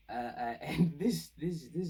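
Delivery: laughing loud and slow, pitch up. Real, not acted. Reviewer cracking up at the scene, laughing. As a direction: break into a real laugh mid-thought.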